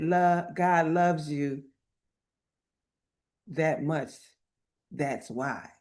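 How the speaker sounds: slow, softening retold answer; tender reassurance. Retells her tender answer.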